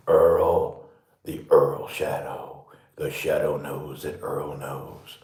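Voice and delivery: low, slow, ominous voice; spooky narrator. Pretend. Making shadows on the wall for the pet, low spooky voice. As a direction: intone it like an old radio mystery.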